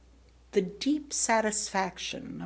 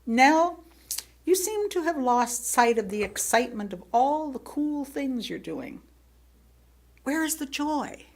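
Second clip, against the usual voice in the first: a hint of a caring friend, higher; gentle outsider; 6 semitones higher than usual. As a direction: ask it like a kind friend pointing out the obvious. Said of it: "a person outside of our family".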